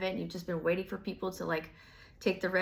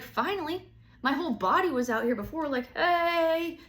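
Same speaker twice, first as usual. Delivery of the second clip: high, loud, relieved greeting; 8 semitones higher, 5 dB louder than usual. Retold or imitated. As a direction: call out, finally relieved. You voiced relieved.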